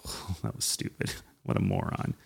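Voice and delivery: scornful whisper with a laugh; a mocking aside whispered behind someone's back; whispered mockery. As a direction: sneer it under your breath. Colleagues silently mocking an idea.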